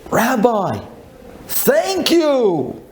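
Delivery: high, drawn-out exclamation; effusive thanks. Retold or imitated. Lady phones the rabbi to thank him, effusively.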